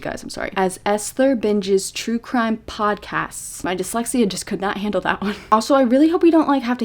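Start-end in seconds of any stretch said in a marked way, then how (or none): 0.6-3.6 very slow, each word placed carefully; slow deliberate reading after tripping over the words; slow careful reread. Real, not acted. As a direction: spell it out slowly after stumbling. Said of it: "I'm losing my ability to speak".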